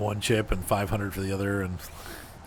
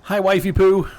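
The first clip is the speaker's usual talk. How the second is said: put-on high, loud, failed New Zealand accent; bad New Zealand accent; 10 semitones higher, 8 dB louder than usual. Streamer greeting a viewer in a bad New Zealand accent. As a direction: greet her in a hammy fake Kiwi accent. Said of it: "That was not a good New Zealand accent".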